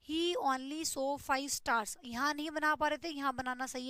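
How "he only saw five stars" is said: very slow, word by word; slow model sentence; slow dictation. Shown as a demonstration. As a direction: dictate the example slowly. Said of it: "सही लिखा हुआ है".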